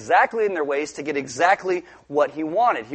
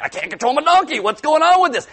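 higher, louder flustered protest; a rider losing face, voice climbing; embarrassed Balaam; 9 semitones higher, 5 dB louder than usual. Acted. Balaam embarrassed before his servants, higher and louder.

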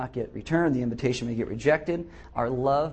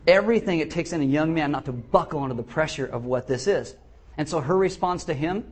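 ordinary delivery

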